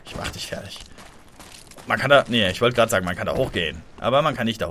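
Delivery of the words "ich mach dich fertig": breathy menacing whisper; creepy whispered taunt; whispered threat. Acted. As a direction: whisper it like a villain. Taunting enemy in game.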